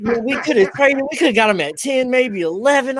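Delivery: high, loud exasperated replay of his reaction; frustrated rant. Retold or imitated. Fan reliving his draft-night frustration, loud and high. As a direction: vent like it's draft night again. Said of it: "But I was like".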